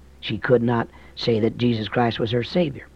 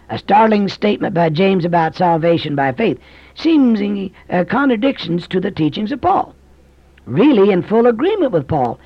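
higher, louder, driving pulpit voice; fervent preaching; 7 semitones higher, 4 dB louder than usual. Acted. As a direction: preach it to the back pew. Preaching at full force.

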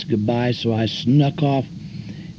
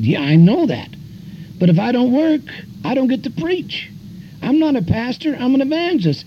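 high, raised comeback; retold retort; 12 semitones higher than usual. Evangelist answering preachers who tell him not to work, high and raised. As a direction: insist you're an evangelist, not a pastor. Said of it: "I said".

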